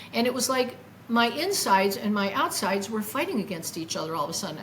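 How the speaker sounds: ordinary talk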